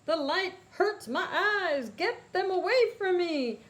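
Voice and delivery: high, loud pained mole wail; blinded villain. Acted. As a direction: howl as the light burns his eyes.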